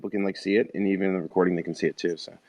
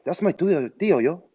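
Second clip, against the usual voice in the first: put-on higher Mexican-accented nephew; comic Mexican homeboy; 6 semitones higher than usual.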